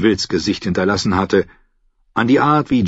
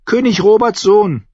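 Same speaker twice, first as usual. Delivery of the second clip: put-on high, proud child's voice; high-pitched boyish proclamation; announcing boy; 13 semitones higher, 5 dB louder than usual. A narrator's reading. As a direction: announce yourself as a high-voiced young lord. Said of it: "verkündete er".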